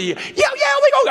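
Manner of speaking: squeaky hurried mock of the young buddy; mock eager friend. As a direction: toss it off like a careless kid. Mocking friend's eager reply.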